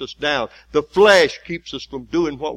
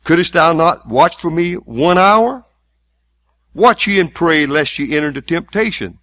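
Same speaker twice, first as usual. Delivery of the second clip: preacher intoning the words of Jesus loudly; preaching at full force; 5 semitones higher, 8 dB louder than usual. Acted. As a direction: declaim the scripture with weight.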